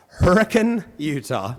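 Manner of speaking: Hurricane said as HUR-uh-kun; local pronunciation. Shown as a demonstration. Utah local correcting a visitor, Hurricane as HUR-uh-kun. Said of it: "That's how we talk".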